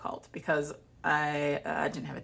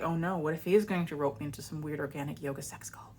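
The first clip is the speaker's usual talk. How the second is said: whispered, playing at dread; mock-panicked whisper; 5 dB quieter than usual. She jokingly entertains the cult theory, in a mock-scared whisper. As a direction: fake paranoia under the breath.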